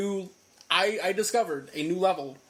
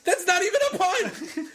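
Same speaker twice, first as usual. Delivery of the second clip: very high-pitched protest; incredulous, worked-up complaint; exasperated outburst; 12 semitones higher, 4 dB louder than usual. Real, not acted. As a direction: cry out in comic indignation. Outraged rant about bad pun.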